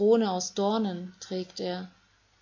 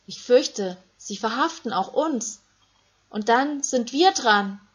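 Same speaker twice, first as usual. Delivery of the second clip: fearful; 4 semitones higher, 6 dB louder than usual. Acted. Frightened disciple fears arrest.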